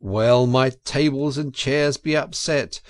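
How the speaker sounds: ordinary delivery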